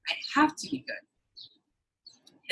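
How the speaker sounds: whispered. Real, not acted. Venting about having to behave.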